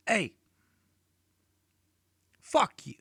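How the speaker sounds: high, loud mock snap from a little boy; hammed-up little boy cussing out dad; aggressive kid. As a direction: talk back like a cheeky son. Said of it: "Getting a little aggressive".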